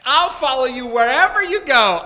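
loud, high, gushing vow; overeager promise. Acted. The scribe gushing his vow at Jesus, loud and high. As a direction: shout it like an overeager recruit. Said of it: "that's the way he comes".

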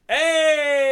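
loud high cheer; cheering hey. Retold or imitated. Friends cheering from across the pool, loud and high. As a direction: whoop like the friends egging him on. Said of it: "they all went".

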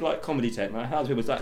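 ordinary talk

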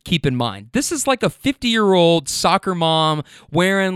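ordinary delivery

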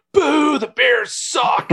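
high, loud, drawn-out boo; heckling shout. Retold or imitated. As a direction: heckle slowly like a rowdy bar crowd. Imitating bar crowd heckling Chicago visitor.

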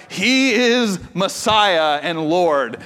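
very slow, portentous; slow solemn announcement; dramatic reading. Retold or imitated. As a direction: read it like movie trailer text. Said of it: "it's like".

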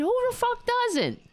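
a high, disbelieving "who the fuck doesn't"; incredulous outburst. Real, not acted. Exasperated retort to quoted claim.

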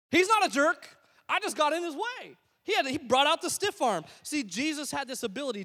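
preaching emphasis. Real, not acted. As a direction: lift your voice and press it. Sermon point driven home.

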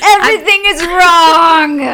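slow, loud wail of woe; mock despair. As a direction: wail it like the world is ending. Mock despair over elbows.

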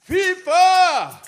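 a big, high chorus yell; crowd-rousing chorus shout. Acted. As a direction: belt the word high and loud.

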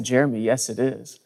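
low, soft, patient reassurance; patient mother. Retold or imitated. Mother answering her doubting son, patiently. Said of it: "she would just say very patiently".

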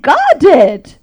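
loud, high, very slow emphatic declaration; triumphant. Retold or imitated. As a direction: savour each word in triumph. Triumphant retort to therapist.

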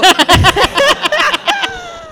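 high burst of laughter. Real, not acted. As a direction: crack up at the memory. Laughing at own story.

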